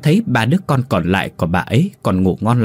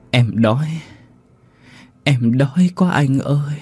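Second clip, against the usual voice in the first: put-on thin, slow pleading child's voice, higher; ghost child voice; 4 semitones higher, slower than usual. A narrator's reading. Ghost child begging, weak. (giọng hồn ma đứa trẻ đói, van nài chậm rãi)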